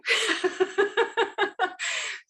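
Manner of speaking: high, teary laugh through emotion; tearful laugh. Real, not acted. Overcome while introducing a beloved friend, teary laugh. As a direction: laugh to hold back tears.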